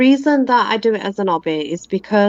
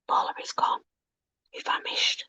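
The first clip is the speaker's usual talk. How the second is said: whisper; 12 dB quieter than usual. Pretend. Jokes the host has vanished.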